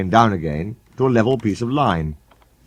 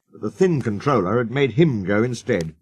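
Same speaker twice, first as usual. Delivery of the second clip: ordinary delivery, nothing marked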